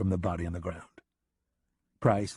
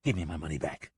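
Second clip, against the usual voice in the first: lower, softer, steady demand; a cheated boss pressing again; insisting; 5 semitones lower, 3 dB quieter, faster than usual. Acted. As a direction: repeat it with quiet menace. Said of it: "Price insisted".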